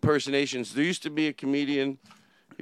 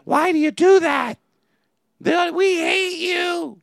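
high, loud gravelly rasp; 7 dB louder, slower than usual. Retold or imitated. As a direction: scrape it out, gravelly Meaney. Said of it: "I can even do the gravelly voice".

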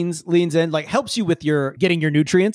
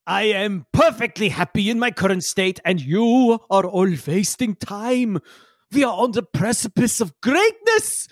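Yigsel, grandiose; 3 semitones higher than usual. Acted. Yigsel rebukes the party for wasting time, grandiose. Game master voices Yigsel.

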